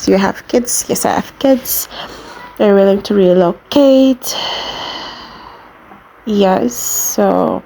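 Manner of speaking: ordinary delivery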